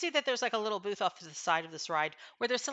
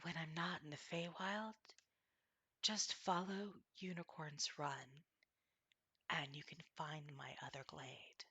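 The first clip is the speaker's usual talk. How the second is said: softer, near-whispered unicorn; soft wise unicorn voice; 3 semitones lower, 13 dB quieter than usual. Acted. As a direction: murmur it like a voice in the mind. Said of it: "a voice in your mind that says".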